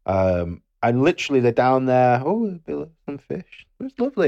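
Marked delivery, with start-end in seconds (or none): 2.2-4.3 dreamy tourist. Retold or imitated. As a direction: coo over the fish like an easily pleased tourist. Voicing Lisa admiring the fish.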